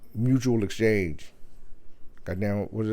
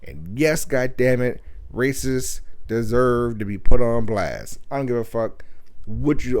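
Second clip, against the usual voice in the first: ordinary talk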